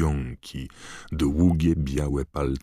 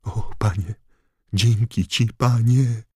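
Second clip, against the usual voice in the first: higher, louder desperate thanks; grateful gushing; 7 semitones higher, 5 dB louder than usual. Acted. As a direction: gush with servile relief. Wormtail thanks his master.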